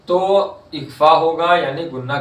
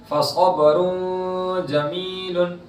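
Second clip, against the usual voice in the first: slow, drawn-out Quranic chanting; tajweed recitation; 3 semitones higher, much slower than usual. Shown as a demonstration. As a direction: stretch the vowels in recitation. Recites example.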